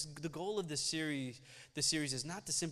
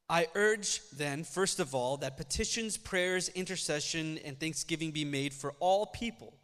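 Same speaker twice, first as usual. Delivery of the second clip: scripture reading; 3 semitones higher, 6 dB louder than usual. Acted. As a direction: proclaim the passage to the congregation. Reads First Timothy passage aloud.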